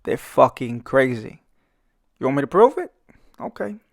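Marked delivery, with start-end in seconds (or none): none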